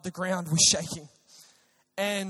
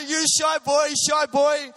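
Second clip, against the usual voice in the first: higher and louder, a playful mock of the hosts' Sri Lankan accent; teasing Sri Lankan accent; 5 semitones higher, 6 dB louder, slower than usual. Sri Lankan hosts teasing the young preacher, mock Sri Lankan accent. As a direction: tease him as a shy boy in a hammed-up Sri Lankan accent. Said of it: "I can't do any accent".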